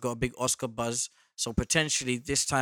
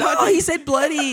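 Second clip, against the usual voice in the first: much higher, louder mock delight; mock delighted squeal; 10 dB louder than usual. S0 mimics American girls reacting to his accent, high squeal. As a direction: squeal it like a thrilled fan. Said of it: "And I said".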